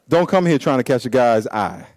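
ordinary talk

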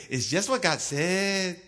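put-on slow drawled accent voice. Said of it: "I just wanted to use that accent".

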